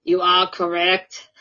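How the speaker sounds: put-on a light Austrian Schwarzenegger accent, a bit slow; Arnold accent. Host announcing the answer in a light Arnold accent. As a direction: play a mild Arnold voice as the quizmaster. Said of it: "I didn't sound as Irish, did I?".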